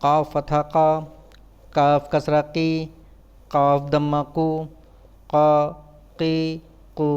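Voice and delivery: even, monotone syllable-by-syllable drill; measured classroom letter drill; spelling drill recitation. Shown as a demonstration. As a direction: recite it like a spelling chant, pausing between items.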